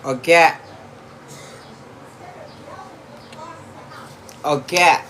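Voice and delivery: shouting to another room; yelling across the house. Real, not acted. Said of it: "I can't keep yelling across the house".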